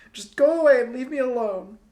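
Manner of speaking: high, loud, raw cry to be left alone; tearful shout. A narrator's reading. Star girl ordering Tristan away, tearful shout. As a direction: scream it through tears. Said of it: "all raw and gulping".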